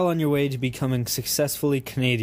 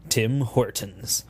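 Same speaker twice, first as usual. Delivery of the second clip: ordinary talk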